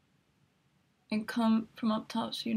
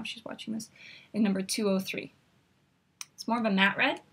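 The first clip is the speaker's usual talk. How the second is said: usual speaking voice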